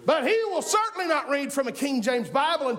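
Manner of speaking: high, driving preacher voice; impassioned pulpit delivery; fired-up preaching. Real, not acted. Preaches at full force.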